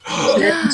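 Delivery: sharp high gasp of shock; hammed-up shocked gasp; mock gasp. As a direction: gasp as if scandalized. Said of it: "Josie gasp".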